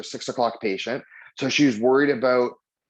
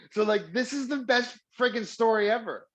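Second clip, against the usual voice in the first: delighted; 10 semitones higher than usual. Real, not acted. Delighted at the story's twist.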